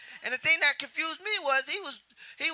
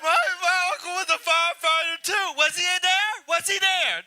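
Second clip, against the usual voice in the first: high, loud mock wail; mock crying; 4 semitones higher, 3 dB louder than usual. A pupil asks after a firefighter uncle, hammed-up crying. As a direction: imitate someone crying over a relative. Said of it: "Everybody crying and shit".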